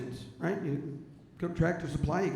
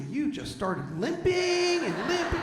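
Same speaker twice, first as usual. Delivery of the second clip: mocking laugh; 10 semitones higher, slower than usual. Wife mocking fake limp.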